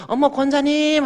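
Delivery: high, bright surprised hello; a churchwoman gushing at the door; delighted greeting. Retold or imitated. Visiting women greeting the deaconess, high and gushing. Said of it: "하면서".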